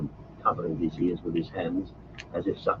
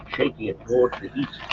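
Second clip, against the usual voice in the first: usual speaking voice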